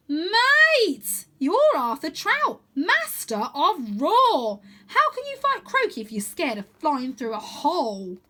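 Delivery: put-on high, loud exasperated boy's voice; bursting out in frustration, drawn out; exasperated Wyn. A narrator's reading. Wyn pleading with Arthur to be brave, exasperated high cry. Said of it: "He gives a cry of exasperation".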